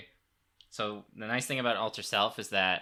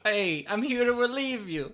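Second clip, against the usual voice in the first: comic guard voice; 12 semitones higher than usual. Pretend. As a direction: play a silly relief guard. Player imitates the guard voice.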